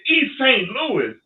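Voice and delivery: high, loud and slow, can't believe it; incredulous echo. Retold or imitated. Echoing the young fighter's answer in high slow disbelief. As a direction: drag it out in disbelief.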